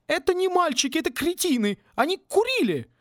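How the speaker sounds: hammed-up furious professor yelling; furious dean. (разъярённая деканша, высоко и громко)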